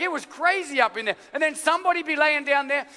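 ordinary talk, nothing marked

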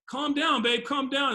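lightly voiced girlfriend pleading; girlfriend calming him. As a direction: soothe him like the worried girlfriend. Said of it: "the girl was like".

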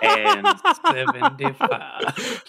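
high laughing squeal. Real, not acted. Player breaks up laughing mid-bid.

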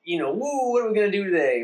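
mock adventurous glee; carefree whoop. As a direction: heroes off on adventure.

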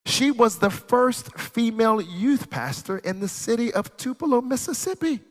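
slow down and preach each phrase high. Acted. Preaching at full force.

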